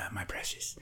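breathy hoarse whisper of precious; whispered Gollum. Retold or imitated. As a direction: whisper it like a hoarded treasure. Echoes the Gollum line.

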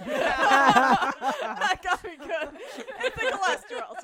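laughing hard through words; can barely talk for laughing. Real, not acted. Cracking up at cholesterol joke.

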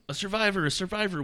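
high call, little added volume; mock yell. Camp lookout spotting a survivor, read as mock yell. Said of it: "was yelled across the camp".

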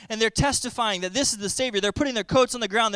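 usual speaking voice

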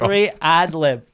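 teasing singsong repeat; mocking echo. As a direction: stretch it out to tease him. Mocking echo of the ad lib line.

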